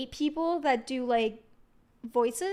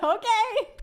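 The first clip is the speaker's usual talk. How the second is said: puzzled; 8 semitones higher than usual. Retold or imitated. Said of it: "And I'm like".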